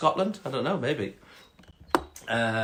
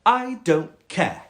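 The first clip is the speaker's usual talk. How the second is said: high, slow, dismissive snobby cat; 5 semitones higher, much slower than usual. Acted. Cat dismissing the frog's complaint, snobby and slow. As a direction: brush the frog off snootily, drawn out. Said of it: "quite a snobby cat".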